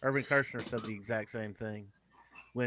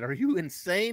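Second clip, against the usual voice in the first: higher, louder disbelief; incredulous agent; 8 semitones higher, 6 dB louder than usual. Retold or imitated. Agent confronting client who turned down the movie, incredulous. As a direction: imitate someone aghast at a refusal.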